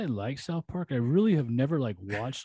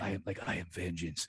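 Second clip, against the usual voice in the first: imitated low, hushed superhero voice; mock-grave, low and quiet; Batman; 7 semitones lower, 8 dB quieter than usual. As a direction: drop low and soft for a brooding vigilante line. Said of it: "like, that sound".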